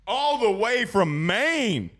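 slow, high, drawn-out intro; announcer drawl. Acted. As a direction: stretch it like a ring announcer.

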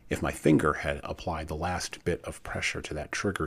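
usual speaking voice

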